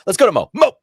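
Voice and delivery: high, loud, manic toss to the announcer; coked-up game-show host. Acted. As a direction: shout it like a wired host. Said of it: "he was just coked up".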